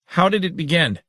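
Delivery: high, eager demand; excited demand. A narrator's reading. Saren hops about demanding how it began, high and eager. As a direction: hop with excitement as you ask. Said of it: "demanded Saren".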